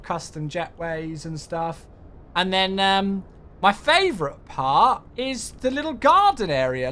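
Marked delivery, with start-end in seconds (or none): none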